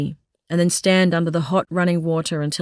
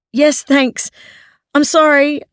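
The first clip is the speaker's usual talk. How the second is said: raised, higher embarrassed call; calling back embarrassed; 8 semitones higher, 5 dB louder, slower than usual. Acted. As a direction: call up, flustered and apologetic. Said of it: "I call back, embarrassed".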